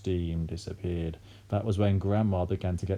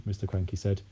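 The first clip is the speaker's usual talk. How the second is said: usual speaking voice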